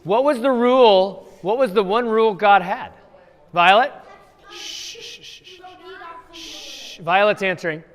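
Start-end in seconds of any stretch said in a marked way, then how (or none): 4.5-7.0 whispered hush to the class; real hushing whisper; hushing shh. Real, not acted. Hushing kids so Violet answers.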